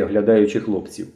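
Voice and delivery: ordinary delivery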